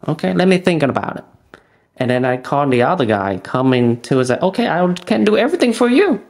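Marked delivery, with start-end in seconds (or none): 0.1-1.2 quick, higher polite brush-off. Retold or imitated. Telling the roofer he'll think about the price, quick brush-off. 4.4-6.2 high, fast eager contractor voice; salesman voice. Retold or imitated. Imitating the roofer offering the whole job, eager salesman.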